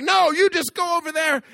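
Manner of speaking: high, loud dismissive snap; mock angry wife. Pastor imitating the wife ordering her husband away, mock angry. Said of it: "and she's like".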